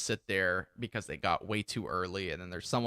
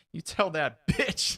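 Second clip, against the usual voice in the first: slow, higher-pitched retort; retold angry retort; 6 semitones higher, 3 dB louder, slower than usual. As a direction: draw out the insult, stopping short of a shout. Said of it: "He's like".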